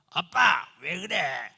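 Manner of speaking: put-on loud child's whine at dad; mock a spoiled kid snapping at his father; imitating a rude son; bratty son. Child talking back to dad.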